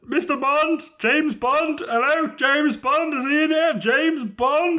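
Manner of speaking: high-pitched bumbling caller; Mister Bean. Acted. Mister Bean hunting for Bond in a crowd, high-voiced impression. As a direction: call the name out in a strained high put-on voice. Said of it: "Mister Bean rolls up going like".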